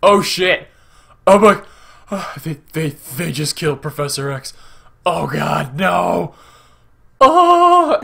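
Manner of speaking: halting horrified outburst, played up; re-enacted shock. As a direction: cry out in mock disbelief. Re-enacting own shock at Charles's death.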